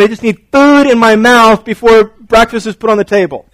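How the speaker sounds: high, loud whine with a laugh; laughing while mimicking a hungry boy; whiny kid. Pastor imitating sons wanting food before breakfast. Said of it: "they're not even awake yet".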